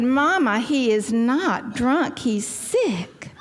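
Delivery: high, drawn-out mock protest; indignant denial. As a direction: insist slowly to Mama, hammed-up. Acted denial, arguing with mother.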